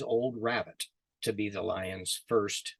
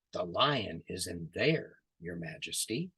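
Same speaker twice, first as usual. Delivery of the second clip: sly hushed rabbit; 6 semitones lower, 7 dB quieter than usual. Acted. Rabbit quietly points the lion to the well, hushed and sly. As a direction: lure the king in with a quiet hint.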